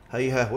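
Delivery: هيهاه as hayhaah, t replaced by h; pausing on h. Shown as a demonstration. Teacher answering how one pauses, هيهاه ending in h. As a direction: close هيهاه on a breathed h. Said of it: "إذا وقف على هيهات يقول إيه؟".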